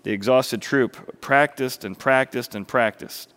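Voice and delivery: ordinary delivery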